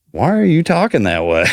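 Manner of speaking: high teasing question; lightly imitating a puzzled wife; wife's quizzical question. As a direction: poke fun at his accent. Wife quoted mocking accent.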